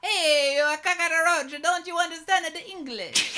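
broad cartoon Italian accent; heavy Italian accent. Officer berating the detective, thick Italian accent. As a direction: scold in hammed-up mock-Italian. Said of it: "In his heavy Italian accent".

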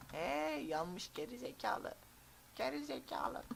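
high, slow mocking yell; high exasperated cry. Real, not acted. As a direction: shriek at him in disbelief. Shouting at burning teammate.

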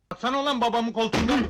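angry shouted order. Acted. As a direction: shout the repeated order, rushing it. Emri bağırarak tekrarlıyor. (öfkeyle, hızlıca bağırarak)

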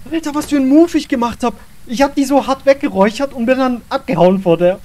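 loud, breathless triumph; excited. Real, not acted. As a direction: brag loudly about the escape. Excited after escaping the ghost.

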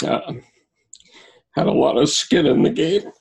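fighting back tears to get the words out; choked up. Real, not acted. Veteran recalling the Navy chapel, choking up with emotion. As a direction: voice rising tight and high after a long, struggling pause.

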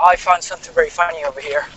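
normal talking voice

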